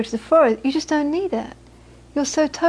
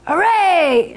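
cheering hooray; 6 semitones higher, 3 dB louder than usual. Retold or imitated. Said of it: "Geshe stands up".

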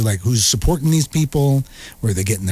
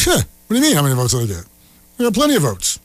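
mock indignant candidate; much faster than usual. Imagined write-in candidate dialogue.

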